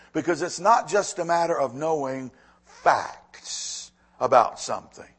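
usual speaking voice

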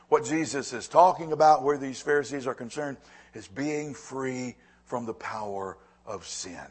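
normal talking voice

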